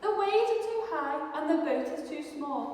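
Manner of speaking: high, fast fearful mouse voice; frightened mouse. Acted. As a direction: panic at the waves. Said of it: "Mouse shivered".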